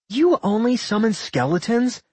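high-pitched mocking question. A narrator's reading. Nat sneering at Rowan's skeletons, mocking. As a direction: scoff at a feeble threat, pitched right up. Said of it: "Nat mocked".

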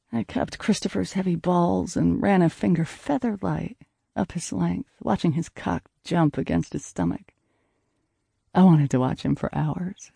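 ordinary delivery, nothing marked

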